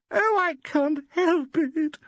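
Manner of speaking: high slow tearful wail; a weeping actress protesting. A narrator's reading. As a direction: wail helplessly. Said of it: "wailed Valerie".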